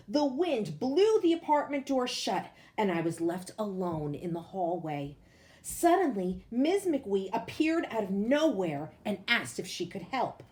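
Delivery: plain reading voice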